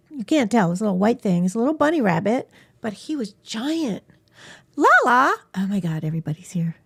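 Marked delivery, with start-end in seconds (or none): 4.8-5.3 high, loud call to the cat; excited call. Real, not acted. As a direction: greet the cat with delight. Calling cat arriving.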